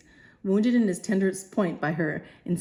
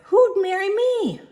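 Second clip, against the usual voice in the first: high, loud Cockney retort; Cockney girl; 6 dB louder than usual. Acted. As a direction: fling it up high like a flower girl.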